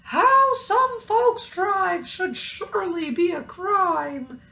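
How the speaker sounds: high, indignant cow voice; huffy cow scolding other drivers; cow crying out. A narrator's reading. Mabel the cow complaining, high and indignant. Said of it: "Mabel cried".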